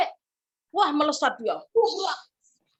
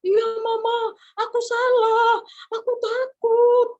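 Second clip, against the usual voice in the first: put-on high, loud wailing child; mock crying; 8 semitones higher, 6 dB louder, slower than usual. Boy confessing to his mother, crying. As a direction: bawl it slowly like a frightened boy. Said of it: "jangan nangis dulu".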